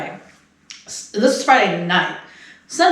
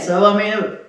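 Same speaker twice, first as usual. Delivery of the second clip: ordinary delivery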